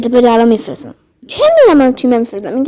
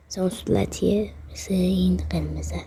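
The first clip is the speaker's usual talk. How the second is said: softer, voiceless aside; whisper; 6 semitones lower, 15 dB quieter than usual. Real, not acted. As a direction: whisper it like a secret. Comparing colours in an aside. (زمزمهٔ آهسته)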